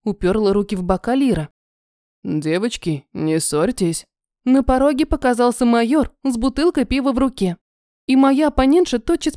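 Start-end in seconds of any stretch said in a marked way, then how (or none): 2.2-4.1 low calm man's voice; gently calm the quarrel, low; major's voice. A narrator's reading. Майор говорит низким голосом. (низкий мужской голос)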